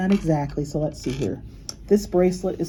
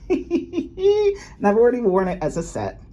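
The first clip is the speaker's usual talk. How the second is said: laughing; 7 semitones higher, 4 dB louder, slower than usual. Real, not acted. Laughing while showing off.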